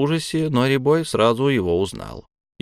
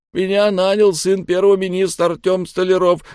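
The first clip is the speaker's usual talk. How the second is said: high, loud, rushed confession; blurted in one breath; 10 semitones higher, 5 dB louder than usual. A narrator's reading. Terrified captive naming his employer, blurted in one breath. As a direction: spit it all out in one breath. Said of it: "выпалил он на одном дыхании".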